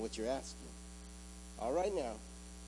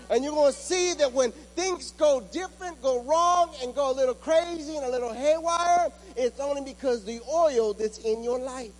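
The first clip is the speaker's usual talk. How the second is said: louder and higher, driving every phrase home; full-force preaching; 9 semitones higher, 7 dB louder than usual. Acted. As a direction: hammer the promise at the congregation, voice ringing. Preaching in God's voice.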